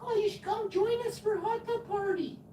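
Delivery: put-on squealy hot-tub flirts; giggly neighbour women. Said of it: "they're giggling, and they're like".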